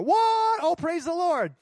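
very high what, then praise; delighted surprise. Retold or imitated. Friend hears the trip is paid, surprised. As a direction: squeal with surprise then thanks.

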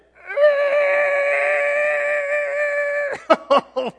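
long, very high, loud screech; drawn-out piercing comic squeal. Acted. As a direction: shriek like brakes slammed on. Sudden screech after the command.

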